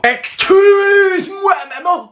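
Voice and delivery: high, very slow drawn-out outrage; a kid discovering his mom is the new coach; stunned excuse-moi. Acted. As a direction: stretch the disbelief out. Shocked: mother is new coach.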